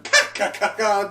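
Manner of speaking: high mock villain laugh ka ka; imitating an evil cackle; cackling traitor fleeing the scene. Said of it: "Kanjuro just laughing".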